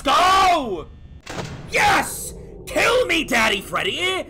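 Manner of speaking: high, loud plea at the screen; real excited gamer shout; eager shout. Real, not acted. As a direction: yell for the monster to come. Streamer calls the animatronic to kill.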